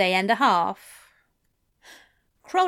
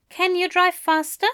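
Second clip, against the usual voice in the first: Aziraphale voice; 8 semitones higher than usual. A narrator's reading.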